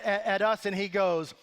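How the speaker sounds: normal talking voice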